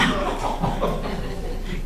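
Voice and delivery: hushed breathy chuckle; a quiet laugh at herself; soft breathy laugh. Real, not acted. Admitting she thought that, soft breathy laugh.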